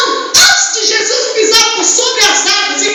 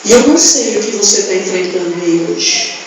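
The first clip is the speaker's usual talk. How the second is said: low intimate preaching; 9 semitones lower than usual. Acted. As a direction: draw them close with a low voice.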